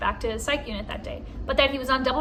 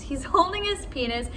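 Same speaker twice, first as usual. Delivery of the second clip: ordinary delivery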